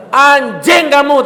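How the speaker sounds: loud, high, slow cursing snap; mock angry curse. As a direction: spit the insult out slowly and loud. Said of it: "tak gitu kan".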